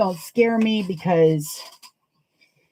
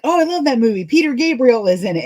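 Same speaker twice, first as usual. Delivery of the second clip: high, loud mock gushing about a movie; gushing fan; 6 semitones higher, 6 dB louder, faster than usual. Movie fan blurting out the wrong actor's name, gushing. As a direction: play up the starry-eyed enthusiasm, louder and higher. Acting out her own gushing mistake.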